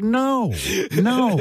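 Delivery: high, clipped double no; a high mock no to the studio head; high flat refusal. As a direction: shut him down in a high voice. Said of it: "and she's like".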